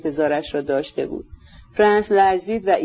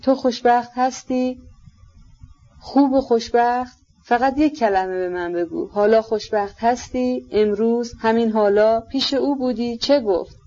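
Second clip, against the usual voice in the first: hurried questions; 5 semitones higher than usual. A narrator's reading.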